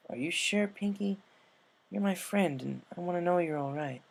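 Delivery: a hint of a caring friend; Rainbow, caring. Rainbow checks on her friend.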